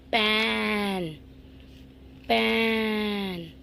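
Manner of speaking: पैन drawn out slowly with a wide open ए; slow exaggerated पैन for children; stretched big-mouth vowel. Shown as a demonstration. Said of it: "big mouth open हुआ है".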